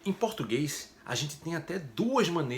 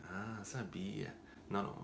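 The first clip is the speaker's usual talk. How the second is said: mock resigned student; 3 semitones lower, 12 dB quieter than usual. Student voice sighs.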